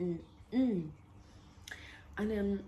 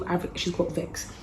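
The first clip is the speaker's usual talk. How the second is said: ordinary talk, nothing marked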